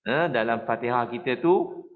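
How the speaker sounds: ordinary talk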